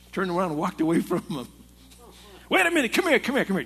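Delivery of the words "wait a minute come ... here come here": higher, louder imitated call after someone walking off; calling back. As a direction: boss calling the worker back, mock-shouted. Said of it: "boss calls him back".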